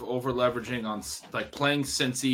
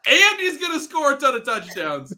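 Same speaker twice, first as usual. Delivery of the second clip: high, louder, fired-up boast; excited; 12 semitones higher, 5 dB louder than usual. Real, not acted. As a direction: sell the bold prediction with glee. Hyping Derrick Henry prediction.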